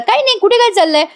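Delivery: high, startled innocent denial; mild mock startled protest; caught-out denial. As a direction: quickly play dumb. Family hiding secret from Sayali.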